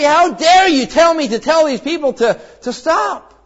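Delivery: hammed-up outrage he never felt; mock indignant retort. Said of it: "He wasn't angry".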